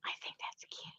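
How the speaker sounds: very soft, voiceless whisper; whispered aside. Real, not acted. Showing off a new stamp to the viewers, whispering. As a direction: whisper it as a private little aside.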